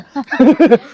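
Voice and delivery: a burst of high, loud laughter; hearty laugh. Real, not acted. Laughs at Daihatsu joke.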